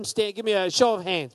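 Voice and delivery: ordinary talk, nothing marked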